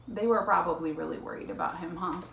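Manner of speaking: plain reading voice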